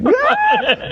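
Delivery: very high, ecstatic imitation squeal; mock fangirl scream. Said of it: "like one of those girls in the Beatle videos".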